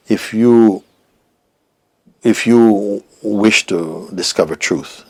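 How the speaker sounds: slow, loud, weighty teacher's voice; teacher's pronouncement. Retold or imitated. Teacher addressing a seeker, slow pronouncement. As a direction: proclaim it with long pauses. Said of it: "And he told me".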